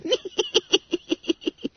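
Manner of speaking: squeaky schoolboy tee-hee-hee; very high, repeated giggle; high cackling giggle. A narrator's reading. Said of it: "cachinnated Bunter".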